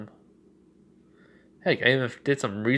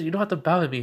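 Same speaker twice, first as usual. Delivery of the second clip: higher-pitched woman's voice, protesting; protesting Inko; 7 semitones higher, much faster than usual. Acted. Mother to the shadow bowing to her, protesting high voice. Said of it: "and she says".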